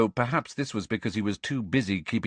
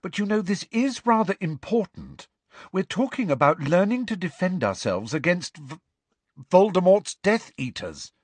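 higher, pressing schoolgirl urging the group; insistent and a bit louder by the end; earnest Hermione; 11 semitones higher, 4 dB louder than usual. A narrator's reading. Hermione pressing her point.

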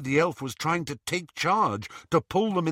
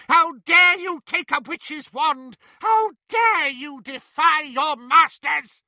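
higher, louder, slow outrage; raging witch; 12 semitones higher, 6 dB louder than usual. A narrator's reading. Bellatrix scolding the elf, raging. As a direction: spit each question in fury.

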